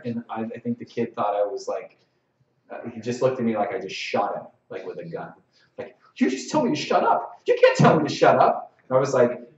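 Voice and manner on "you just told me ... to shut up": high-pitched mock outrage; indignant player. Player protesting to the ref, high mock indignation. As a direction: mimic a shocked kid, voice jumping up.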